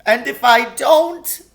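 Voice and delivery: high, anxious faun voice; a fretful, sorrowful faun. A narrator's reading. Tumnus answering.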